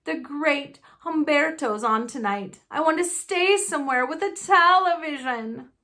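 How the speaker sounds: put-on high, pleading spoiled boy; whiny Dudley. Spoiled Dudley whining to his mother for a television. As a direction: whine it like a spoiled kid begging.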